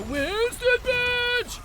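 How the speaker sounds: slow, loud, high hype; excited. Real, not acted. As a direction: sell it like a prize. Hyped announcing the badge.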